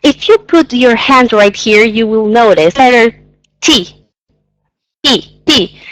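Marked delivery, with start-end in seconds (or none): 3.6-5.8 T puffed out with a burst of air; T, spit the air out; breathy T with a burst of air; aspirated T. Shown as a demonstration. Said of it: "the air comes from to the outside".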